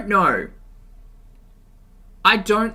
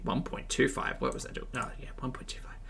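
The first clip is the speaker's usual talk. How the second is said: whispered to self; 12 dB quieter than usual. Real, not acted. Muttering to herself while adjusting speed.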